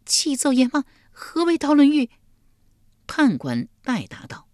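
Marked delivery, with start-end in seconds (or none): 0.0-2.1 much higher and louder, frightened; trembling question. Acted. Yulian asks Yama about the punishment, high and scared. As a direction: ask in terror. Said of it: "玉莲战战兢兢地问道".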